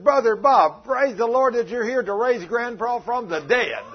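little grandchild cheering the pastor's arrival; excited grandchild. Acted. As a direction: play an eager kid calling out across the hall.

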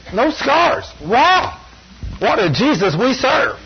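high, amazed cry of wow; excited exclaim. Real, not acted. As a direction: jubilant preacher's wow. Exclaims miracle.

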